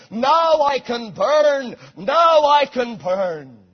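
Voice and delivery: loud, drawn-out cry of victory; retold martyr's ringing declaration; triumphant cry. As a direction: proclaim it triumphantly, slow and loud. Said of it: "he cried triumphantly".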